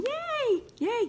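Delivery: very high, happy cheer; thrilled high-pitched yays; excited yay. Real, not acted. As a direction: squeal it with delight. Viewer walking near her town.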